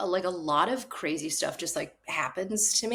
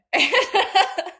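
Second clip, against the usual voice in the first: bright burst of high laughter; 13 semitones higher, 7 dB louder than usual. Real, not acted. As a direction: laugh out at the absurd ending. Laughing at her own story.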